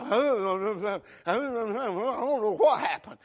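slow, hammed-up blubbering; mock whimpering. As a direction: play dumb with a long fake sob. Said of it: "here they are acting all like".

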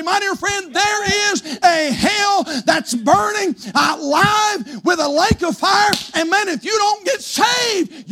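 high, driven pulpit preaching; full-force preaching. Real, not acted. Preacher warning the congregation of hell, full-force preaching. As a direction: preach it with long, leaning stresses.

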